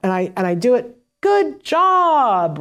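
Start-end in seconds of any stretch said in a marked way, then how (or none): 1.2-2.6 high, bright praise; cheery praise. Shown as a demonstration. Therapist praising after hand-over-hand prompt, cheery. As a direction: reward them right away with your voice. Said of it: "full prompt is that I hand over hand".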